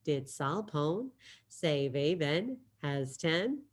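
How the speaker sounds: slow, quiet say-along; teacher saying the phrase along with students; slow choral repeat. Shown as a demonstration. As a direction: lead the class through it slowly. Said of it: "Go ahead, say it with me".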